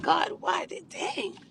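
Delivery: high, soft, half-whispered exasperation; frustrated complaint. Retold or imitated. Her old self complaining to God, high and breathy. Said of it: "I used to be like".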